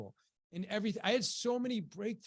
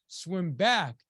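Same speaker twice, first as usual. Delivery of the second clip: louder, firm retold command; firm coach order; 5 dB louder than usual. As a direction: give the coach's order firmly. Coach gives first order in story.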